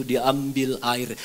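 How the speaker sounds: usual speaking voice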